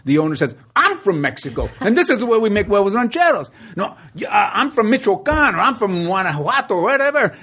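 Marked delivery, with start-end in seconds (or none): 0.8-3.5 the restaurant owner's insistent reply, pitched higher; arguing back, getting worked up; a proud owner defending his dish in a mock quarrel; heated retort. 3.8-7.3 higher, heated macho arguing; one-upping him over where he's from; heated argument. Retold or imitated. Willie arguing with the owner.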